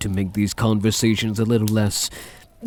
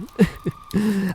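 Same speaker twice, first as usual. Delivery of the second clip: sudden high mocking laugh; 12 semitones higher than usual. Vampire mocks hunter's hopes.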